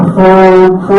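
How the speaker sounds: loud blaring honk; ship horn imitation. Storyteller imitating the ship bearing down, loud horn honks. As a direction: blast it out like a ship's horn. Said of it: "He's honking".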